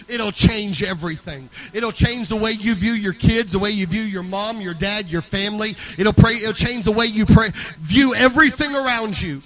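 loud, driving sermon delivery; full-force preaching. Real, not acted. Preacher urging the church to praise and pray, loud forceful preaching.

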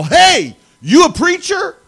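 calls out loud and high across the room; a stranger calling across a gas station, mock yell; retold yell. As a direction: hail a stranger from a distance with a shout. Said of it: "I hear this guy yell".

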